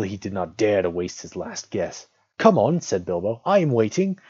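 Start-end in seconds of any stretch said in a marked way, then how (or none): 2.4-2.8 high, loud urging; bold and cheerful. A narrator's reading. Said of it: "He tried to sound bold and cheerful". 3.4-4.2 high, loud sing-out; loud forced confidence; bold and cheerful. A narrator's reading. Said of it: "He tried to sound bold and cheerful".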